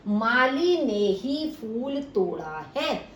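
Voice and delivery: slow, Mali stressed and lifted. Shown as a demonstration. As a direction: lean hard on Mali. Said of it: "माली के ऊपर विशेष बल". (माली पर ज़ोर देकर धीरे बोलना)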